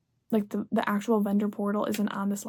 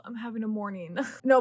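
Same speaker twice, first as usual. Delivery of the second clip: normal talking voice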